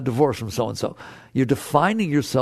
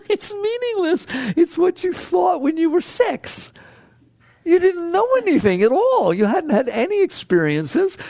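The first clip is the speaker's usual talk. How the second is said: mocking disbelief. High mocking voice.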